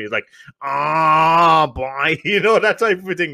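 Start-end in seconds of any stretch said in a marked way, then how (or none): 0.6-2.2 long, drawn-out mock Dublin drawl; Dublin accent. Joking to co-host about slow Dublin speech, drawn-out Dublin accent. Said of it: "that's what Dublin accents sound to me".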